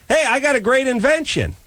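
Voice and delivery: high, eager inventor's pitch. Retold or imitated. Patient pitching his idea to the dentist, high and eager. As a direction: sell the idea brightly. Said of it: "And I would say".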